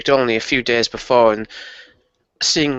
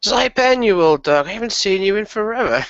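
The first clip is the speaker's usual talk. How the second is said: delighted greeting; 9 semitones higher than usual. Acted. Player greeting a long-unseen old acquaintance, delighted and high-pitched.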